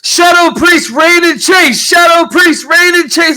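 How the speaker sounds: a crowd cheering the heroes, loud; crowd yelling. Acted. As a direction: cheer the names like a roaring crowd. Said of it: "the people yell and say".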